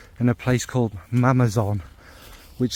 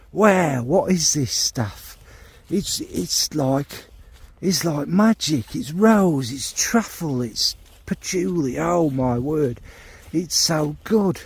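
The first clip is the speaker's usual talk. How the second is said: slow, raised-pitch wonder; awestruck amazement; 4 semitones higher, slower than usual. Acted. Johnny Geezer sniffing his old fragrance, awestruck amazement. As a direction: marvel at each note as you find it.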